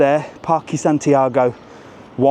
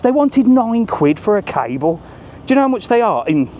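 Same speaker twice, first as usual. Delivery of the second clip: higher, fast and incredulous; outraged price rant, pitch jumping up; indignant outburst; 9 semitones higher than usual. Real, not acted. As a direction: spit it out in disbelief at the price.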